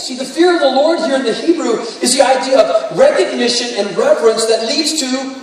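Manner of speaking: ordinary talk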